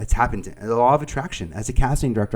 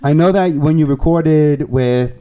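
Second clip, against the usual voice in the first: loud, slow attempt at Staten Island accent; higher, badly faked Staten Island twang; 4 semitones higher, 7 dB louder, slower than usual. Asking a star a question, in a botched Staten Island accent. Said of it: "that's not Staten Island".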